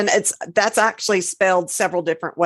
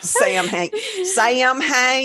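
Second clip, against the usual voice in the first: drawn-out, hammed-up Georgia twang on "Sam Hain"; Georgia accent; 7 semitones higher, much slower than usual. Co-host teasing the festival's name, mock Georgia accent. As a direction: playfully stretch "Sam Hain" the Georgia way, pitch rising. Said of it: "Sam Hain for those other Georgia people".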